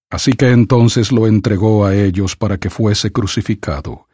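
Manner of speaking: plain reading voice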